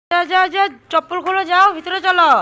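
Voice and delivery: loud, high scolding order; urgent shout. Real, not acted. Mother ordering child inside.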